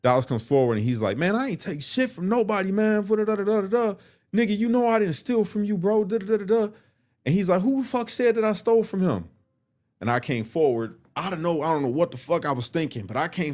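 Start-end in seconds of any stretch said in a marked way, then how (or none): 1.2-6.7 indignant shouting inmate; heated denial. Retold or imitated. Dallas denying theft heatedly. 7.6-9.3 angry accused man; indignant demand. Retold or imitated. Dallas demanding an answer.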